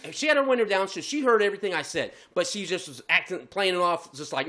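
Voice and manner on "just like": usual speaking voice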